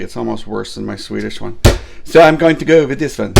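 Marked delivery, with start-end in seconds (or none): 2.0-3.3 loud, higher booming Viking. Acted. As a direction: proclaim it like a Viking. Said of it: "I would do a Danish accent".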